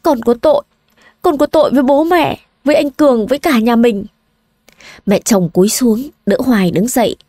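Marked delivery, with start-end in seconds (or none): 0.0-4.1 high, tearful daughter-in-law begging forgiveness; pleading confession. Acted. Daughter-in-law confessing to her in-laws, high and pleading. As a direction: confess your guilt with a rising, pleading voice.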